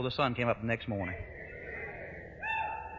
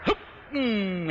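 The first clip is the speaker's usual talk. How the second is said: short hoot swallowed into a sliding hum; imitated struggle to hold in a whoop; whoop being stifled; 4 semitones higher than usual. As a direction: clamp down on a holler, humming. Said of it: "and he got it to there".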